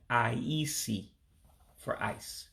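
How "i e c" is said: "I E C" said with a low, falling tone. Shown as a demonstration. Teacher shows the low tone that means ice in Igbo. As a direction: drop the voice on "I E C".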